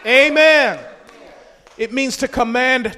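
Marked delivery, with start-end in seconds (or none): 0.0-0.8 high, explosive shout; shout of praise. Shown as a demonstration. Preacher leads the church in Shabach praise, shouting. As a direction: burst out at full volume. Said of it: "let's give God a Shabach praise".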